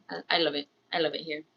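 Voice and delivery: ordinary talk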